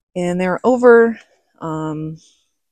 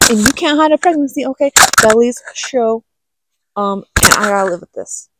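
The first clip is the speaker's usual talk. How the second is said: indignant retort; 3 semitones higher than usual. Retold or imitated. Retold angry retort to boyfriend.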